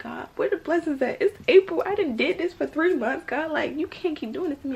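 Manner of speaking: high, loud pleading with God; exasperated plea. Retold or imitated. Acted frustrated plea to God.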